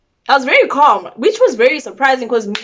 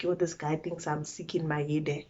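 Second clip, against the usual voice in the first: quiet aside; 6 semitones lower, 16 dB quieter than usual. Retold or imitated. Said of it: "I'm like".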